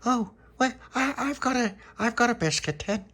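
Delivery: put-on very high, loud little-girl voice; Dolores, eager. A narrator's reading. Dolores offering her biscuit tin, very high and eager.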